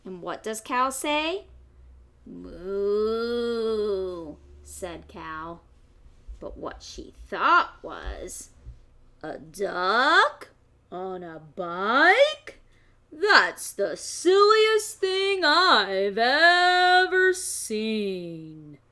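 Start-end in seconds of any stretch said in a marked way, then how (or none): 2.3-4.4 louder mooing cow voice. Acted. 9.2-18.8 louder, slowed disbelieving cow; drawn out, tickled by the silly sight; amused cow. Acted. Cow's thought voiced.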